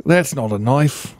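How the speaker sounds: put-on Australian movie-hero accent; Crocodile Dundee. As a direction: do a hammy Aussie accent. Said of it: "Crocodile Dundee".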